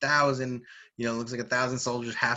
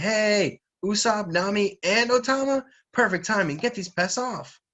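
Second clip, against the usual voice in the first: loud, high-pitched hail to friends; hammed-up eager shout for help; calling out; 9 semitones higher, 6 dB louder than usual. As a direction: call across a battlefield for backup. Frankie calls to supposed reinforcements.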